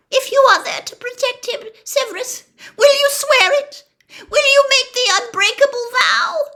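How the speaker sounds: high, loud, fast pleading; Narcissa, frantic. A narrator's reading. Narcissa presses Snape for the vow, high and loud. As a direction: press him to swear.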